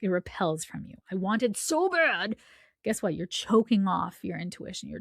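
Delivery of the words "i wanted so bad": slow, straining mock craving; desperate wanting. Energy of wanting, voiced as slow, desperate craving. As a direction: drag it out as if aching for it.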